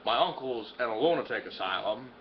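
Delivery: low, slow, incredulous summing up; low deadpan disbelief; deadpan recap. Acted. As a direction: list the disasters slowly and low.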